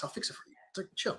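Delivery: soft whispered promise; whispered reassurance. Retold or imitated. Knife maker soothing angry customer, whisper. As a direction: breathe it out to calm him.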